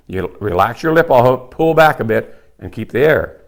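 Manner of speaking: usual speaking voice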